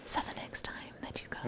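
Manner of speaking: soft, fully whispered mock ASMR; playful ASMR-style whispering. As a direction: whisper it close, like an ASMR creator. Imitating whispered ASMR video talk.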